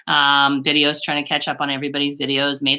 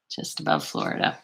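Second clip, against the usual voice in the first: breathy whisper under the breath, "above" slightly leaned on; whispered to herself; 8 dB quieter than usual. Real, not acted. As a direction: echo "Just above Florida" as a soft private whisper. Repeats comment to herself.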